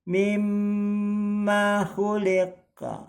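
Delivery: Mimma khuliqa with mm held long, slow and high; held doubled m. Shown as a demonstration. Teacher reciting for students, mm held. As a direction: sustain the mm in Mimma. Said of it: "ini juga sama nih mim tasdid".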